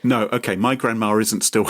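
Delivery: ordinary talk, nothing marked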